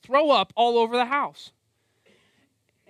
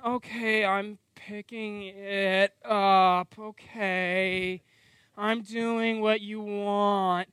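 very slow, grudging drag; mock a kid obeying with a rebellious spirit; sulky compliance; 3 semitones lower, much slower than usual. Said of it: "their spirit is in complete rebellion".

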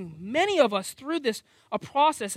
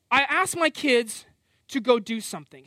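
usual speaking voice

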